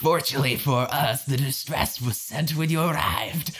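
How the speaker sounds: thick gurgle through every word; underwater gurgling voice. Acted. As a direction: speak as if through a throat full of water. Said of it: "I need you to gurgle".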